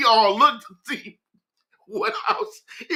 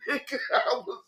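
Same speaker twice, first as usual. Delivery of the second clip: laughing; 3 semitones higher, 7 dB quieter than usual. Real, not acted. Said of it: "I cried laughing".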